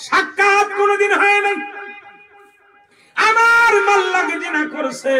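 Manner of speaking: high, shouted declamation; full-force preaching. Acted. Preacher voices the boy's testimony, high impassioned shout. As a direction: cry it out high to the crowd.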